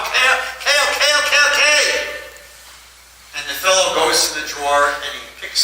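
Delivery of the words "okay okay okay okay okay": high, exasperated okays; fine, fine, just stop; giving in. Retold or imitated. Rich man gives in, exasperated.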